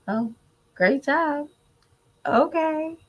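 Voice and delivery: put-on high, lilting cheer for a child; upbeat praise. Mom to son on making honor roll, upbeat praise. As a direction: praise lightly, voice lifting at the end.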